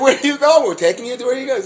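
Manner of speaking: high-pitched child imitation; mock children's voices. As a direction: imitate eager kids calling out. Voices kids carrying his suit.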